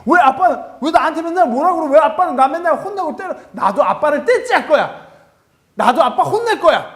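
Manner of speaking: put-on very high, loud child's whine with a laugh; bratty little son. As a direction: sass dad like a defiant toddler. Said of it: "우리 아들이".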